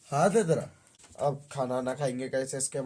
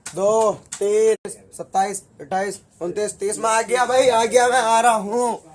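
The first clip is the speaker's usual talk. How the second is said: loud counting; 5 semitones higher, 6 dB louder, slower than usual. Acted. Seeker counts aloud and calls out.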